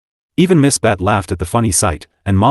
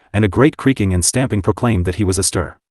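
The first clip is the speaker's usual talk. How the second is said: ordinary delivery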